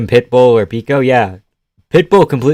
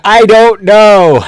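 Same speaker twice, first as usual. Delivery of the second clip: high, loud, drawn-out outburst; exasperated shout; 10 semitones higher, 11 dB louder, much slower than usual. Real, not acted. Streamer answering chat about his bad accent, exasperated shout. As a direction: yell it in exasperation, stretching the words.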